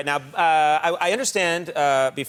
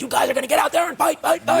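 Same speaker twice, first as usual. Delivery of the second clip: fast, higher fire-up coach bark; fight-pep coach; 12 semitones higher than usual. Retold or imitated. Host imitating a fired-up coach urging the team. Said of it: "the image that I've always had".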